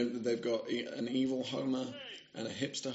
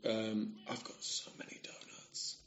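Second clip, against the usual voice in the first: whispering; 4 semitones lower, 11 dB quieter than usual. Real, not acted. Aside about donuts.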